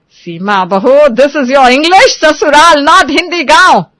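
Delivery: mother-in-law shouting at her village daughter-in-law; furious shout. Acted. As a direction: raise your voice high and loud at the daughter-in-law. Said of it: "चिल्लाने लगती है".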